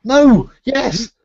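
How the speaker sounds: high, flustered, caught out; flustered panic. Acted. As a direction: squeak out mock panic. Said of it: "but then you said".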